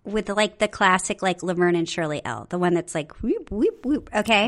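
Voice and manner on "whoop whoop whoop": high little swooping whoops; looping whoop noises. Shown as a demonstration. Showing the co-host a cursive L, playful whoop sounds. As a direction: trace loops with light, high whoops.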